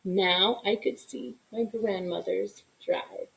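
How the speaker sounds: plain reading voice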